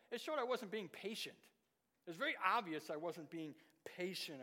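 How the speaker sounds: normal talking voice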